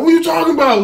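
high, loud, half-laughing panic; mock-panicked, laughing through it; retelling his own nervous freak-out, high and loud. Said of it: "my punk ass getting nervous".

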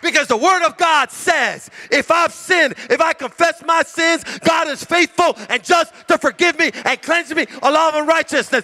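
high, driving sermon voice; preaching at full force. Acted. Pastor preaching scripture to the congregation, full force. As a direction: proclaim it to the whole room.

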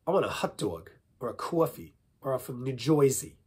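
put-on New Jersey vowels; New Jersey accent. Shown as a demonstration. Accent warm-up, exaggerated New Jersey accent. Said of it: "let's do accent warm-ups".

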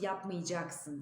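yapmayacaksın as yapmıcaksın; spoken form. Shown as a demonstration. Teacher models the spoken form, yapmıcaksın. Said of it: "diye okuyoruz".

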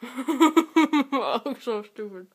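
laughing through stuffed cheeks; loud slow laugh, mouth full. Real, not acted.

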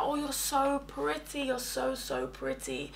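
soft, slow cooing compliment; mock gushing. Two women flattering a girl in the club, mock gushing. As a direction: coo it softly, drawing out the praise.

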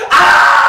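piercing, extremely high and loud scream; banshee scream. Acted. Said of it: "she screams like this".

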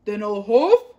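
loud, growly-big wolf threat; wolf threatening the pig, loud and menacing. A narrator's reading. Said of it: "said the wolf".

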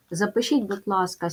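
normal talking voice

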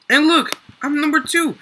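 high and loud, thrilled at ranking up; excited brag. Real, not acted. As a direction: announce it with real excitement. Jumps to second place.

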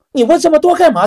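high, loud impatient snap; a waiter losing patience, pitched high. Acted. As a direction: brush him off, annoyed. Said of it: "就有点不耐烦了".

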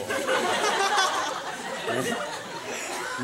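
real laugh with the breath only, no voice; cracking up at his own blunder; silent laugh. Real, not acted. Storyteller cracks up remembering the jacket mix-up, silent laugh.

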